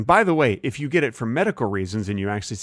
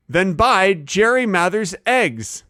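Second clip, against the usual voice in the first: slow, higher hammed-up sponsor read; ad pitch; 8 semitones higher, 3 dB louder, slower than usual. Host reads sponsor talking points to a pretend customer, hammed-up ad pitch. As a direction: put on a slow, lifted sales pitch. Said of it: "I gotta read the talking points".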